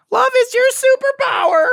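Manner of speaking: very high, loud cartoon squeak; squeaky cartoon character impression; cartoon alien voice. Podcaster mocking an alien's annoying voice, very high cartoon squeak. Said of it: "sounds like a fucking Cartoon Network character".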